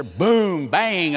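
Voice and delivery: loud, punched storm noises; thunderclap imitation. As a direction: hit each word like thunder.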